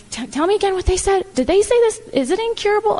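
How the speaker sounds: stammered start, then high incredulous questions; stunned questioning. Retold or imitated. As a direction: re-enact asking in shock, pitch lifted. Retelling her reaction to bad news.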